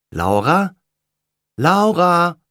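calling out, rising to a loud high cry; calling her name. Acted. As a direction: voices calling into the dark for a lost girl. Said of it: "hört Laura ihren Namen rufen".

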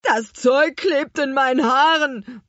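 high, loud whine of protest; complaining. A narrator's reading. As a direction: complain about the sticky mess. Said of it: "beschwerte sich einer". (laut jammernde Beschwerde)

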